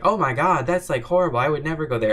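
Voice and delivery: loud, high, aghast retelling; mock-horrified gasp at the idea; playing the friend's loud, high-pitched horror. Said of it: "he's like".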